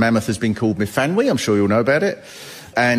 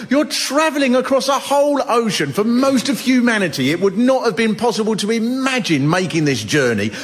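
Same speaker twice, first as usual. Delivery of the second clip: exasperated rant; 9 semitones higher than usual. Retold or imitated. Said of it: "And I thought".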